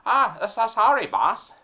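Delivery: eager dim henchman; mafia henchman. Acted.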